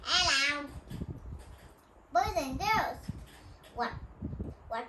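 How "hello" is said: talking bird. Retold or imitated. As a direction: parrot hello, a bit louder. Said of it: "bird is talking".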